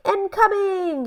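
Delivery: high, called-out warning; yelled warning. A narrator's reading. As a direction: shout it up to the boy. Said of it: "he yelled".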